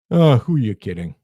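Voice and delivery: a hint of a scoffing inner voice; doubting inner voice. Inner doubter scoffing at your dreams, lightly. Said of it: "and then there's this voice".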